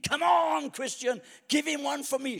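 high shouted ringside cheer; crowd cheering. Acted. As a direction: yell encouragement from the stands. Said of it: "Christians in the audience screaming".

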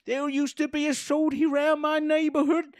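put-on high, slow, eager old man voice; drawn-out creepy welcome, high and slow; high old-timey shopkeeper. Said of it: "my new character".

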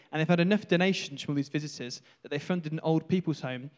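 ordinary delivery

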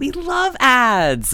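high, slow, gushing sarcasm; overly sweet fake love; mock enthusiasm. Sarcastic praise of ads.

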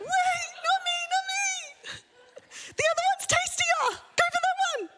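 very high pleading little food voice; squeaky talking food. Pretend. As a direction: squeak it out like frightened food begging. Food talking, begging not eaten.

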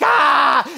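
sharp high yell of frustration; retold angry shout. Pastor retells losing at badminton, loud high angry yell. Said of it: "and I just shout".

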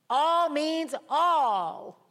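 pounding slow emphasis; stressed slow declaration. Acted. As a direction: preach "All means all" word by word. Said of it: "emphatic slow declaration".

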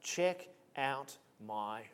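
low, slow, soft smackdown; boastful Paul. Acted. Paul showing off his resume to rivals, boastful. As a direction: draw it out, quietly one-upping the rivals. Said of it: "Paul is saying".

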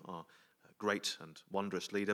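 ordinary talk, nothing marked

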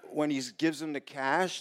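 usual speaking voice

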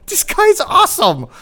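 high, loud, gushing rave; gushing praise. Retold or imitated. Host imitating someone raving about his guest. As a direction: squeal it like a starstruck fan. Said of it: "hear somebody go".

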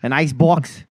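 badly faked Boston accent, rising question; hammed-up Boston vowels; terrible Boston accent. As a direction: ask like a mock Bostonian. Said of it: "in a terrible Boston accent".

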